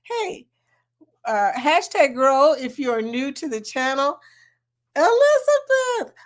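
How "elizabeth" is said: high, drawn-out happy call; calling out excitedly. Real, not acted. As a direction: sing out her name in welcome. Greeting viewer by name.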